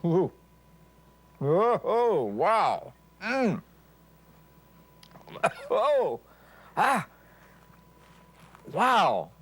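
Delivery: high, breathless oohs and wows; surprised gasps. Real, not acted. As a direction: react to a fiery bite, startled. First taste of real wasabi.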